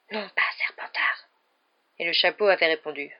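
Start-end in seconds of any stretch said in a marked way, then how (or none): 0.1-1.3 unvoiced urgent whisper; whispered thought. A narrator's reading. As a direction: whisper it like a silent plea. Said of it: "avait pensé de toutes ses forces".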